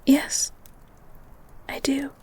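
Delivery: soft, hushed yes; tender whisper. Acted. Girl admits her love to the beast, whispered. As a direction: breathe it like a vow.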